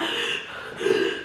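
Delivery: quiet, breathless gasping for air; hyperventilating gasp. Shown as a demonstration. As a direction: show how you can't get a breath in. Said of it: "it's like I'm breathing heavy".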